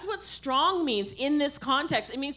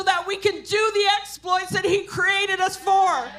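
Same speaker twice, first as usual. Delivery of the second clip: raised, higher sermon voice; forceful preaching; 5 semitones higher, 4 dB louder than usual. Real, not acted. Preacher urging an apologetic church to wake up, forcefully. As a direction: challenge the congregation with punched, ringing words.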